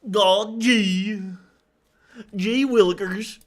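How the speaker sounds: mock the boss's warped southern drawl like Goofy; goofy southern accent. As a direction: parody southern accent, high and slow. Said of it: "She sounds like a, like a Goofy".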